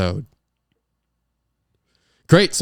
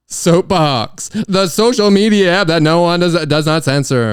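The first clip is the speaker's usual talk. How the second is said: loud, higher pitched announcer pitch; hammed-up ad read; 5 semitones higher, 7 dB louder than usual. Shown as a demonstration. Host redoing the ad to sell it, loud and higher. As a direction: oversell it like a cheesy commercial. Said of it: "I'm giving it a little something".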